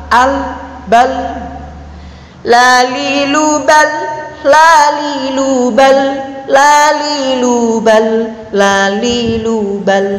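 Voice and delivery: slow chanted drill, "bal" with a medium L; half-held L. Shown as a demonstration. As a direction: intone "lalilu bal", L neither clipped nor released fully. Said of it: "Nah, tengah-tengah ya".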